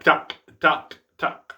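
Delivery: "tuck" with the final K barely sounded; short separate "tuck", the closing K weak. Shown as a demonstration. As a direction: let the K in "tuck" almost vanish. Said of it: "the K syllable is just barely there".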